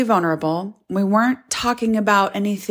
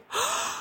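sudden high yawn; yawn gasp; 10 dB quieter than usual. Real, not acted.

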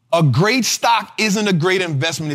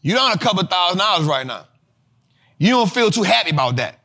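normal talking voice

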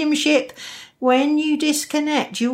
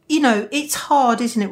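ordinary talk

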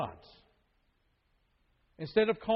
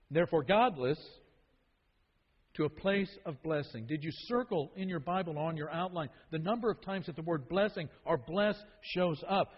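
usual speaking voice

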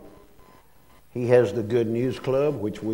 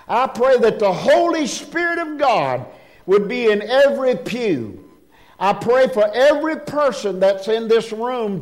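earnest prayer in a raised, high voice; pulpit prayer; 10 semitones higher, 5 dB louder than usual. Real, not acted. Leads congregation in prayer.

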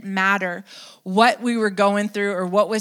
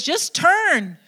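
just turn, said higher and firm; urging call; 7 semitones higher than usual. Acted. Preacher urging repenting people, higher firm call. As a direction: call it out plainly and high. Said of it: "it says".

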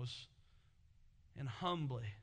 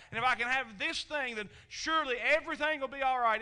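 loud, higher, pleading quote of Hannah; Hannah's pleading prayer; 8 semitones higher, 8 dB louder than usual. Acted. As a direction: beg for it as Hannah would. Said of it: "she prayed and she wanted it so bad".

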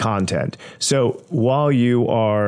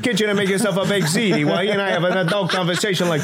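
lightly done New York mobster voice, a bit higher; playing the mob boss who sends the kid off; mobster accent; 6 semitones higher than usual. Making fun of the co-host, a light mobster accent. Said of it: "You're doing the exact same accent".

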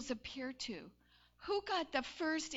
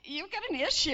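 mock accusation; 4 semitones higher, 7 dB louder than usual. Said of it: "And he's like".

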